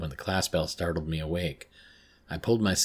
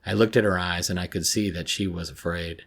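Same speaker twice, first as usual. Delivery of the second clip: usual speaking voice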